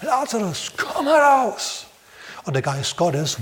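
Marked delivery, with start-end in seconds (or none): 0.0-1.9 high, loud calling out, slowing on "komm heraus". Acted. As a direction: summon him out of the tomb at full voice. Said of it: "mit lauter Stimme gerufen". (Jesus ruft Lazarus laut und hoch aus dem Grab)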